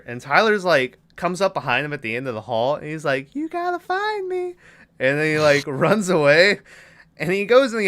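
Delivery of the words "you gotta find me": put-on a kid's singsong taunt, pitched way up; high teasing kid. Boy taunting the doll in the hallway, high teasing singsong. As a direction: tease him like a kid in a game. Tyler voiced teasing Chucky.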